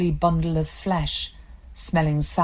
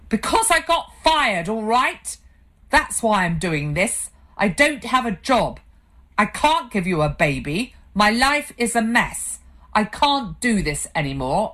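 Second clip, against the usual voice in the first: higher, louder desperate outburst; anguished shout; 5 semitones higher, 4 dB louder than usual. Acted. Husband confessing he was fired, shouting in despair. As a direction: blurt out the truth in despair.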